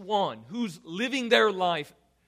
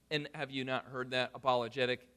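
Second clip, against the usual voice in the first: ordinary talk, nothing marked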